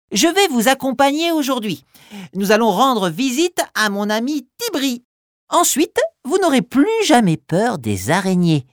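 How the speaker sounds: lightly voiced cheerful guide; friendly guide. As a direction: kindly lead the children. Noisette speaks to the children.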